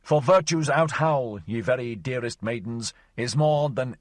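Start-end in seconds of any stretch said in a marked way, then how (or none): 0.0-1.4 mock roar. Mock-heroic roaring.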